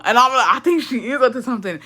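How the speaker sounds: says it through a laugh; laughing while agreeing; amused, half laughing; laughing agreement. Retold or imitated. Laughing reply to mom.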